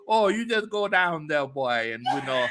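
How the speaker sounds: teasing imitation of a New Orleans accent. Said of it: "a New Orleans accent".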